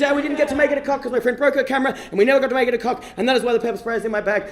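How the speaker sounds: ordinary talk, nothing marked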